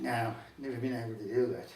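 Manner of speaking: low, darker voice for the devil. Acted. The devil answers Michael.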